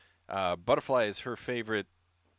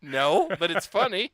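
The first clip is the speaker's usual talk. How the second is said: put-on louder, slow kid voice being cheeky; 6 semitones higher, 7 dB louder than usual. Daughter cheekily telling mom the word is funny, loud. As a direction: play the kid who thinks a rude word is hilarious.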